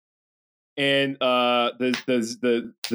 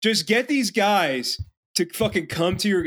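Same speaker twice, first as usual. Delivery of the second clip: ordinary talk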